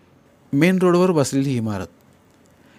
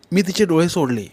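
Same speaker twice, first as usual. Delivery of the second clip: ordinary talk, nothing marked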